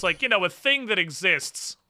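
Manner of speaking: usual speaking voice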